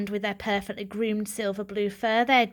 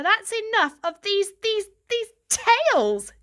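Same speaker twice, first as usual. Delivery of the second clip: high, slow, spitting out "tales"; father scorning the stories; disgusted; 11 semitones higher, 4 dB louder than usual. Acted. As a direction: sputter with disgust. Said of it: "spat out the word tales with particular disgust".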